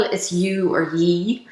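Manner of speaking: slow, drawn-out Irish ye; you or ye. Shown as a demonstration. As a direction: say ye slowly, Irish-style. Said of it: "If I'm just talking to a group".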